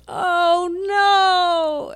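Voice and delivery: high, loud drawn-out cry of alarm; imitated scream. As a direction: act out her scream down the phone. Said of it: "all of a sudden she screamed".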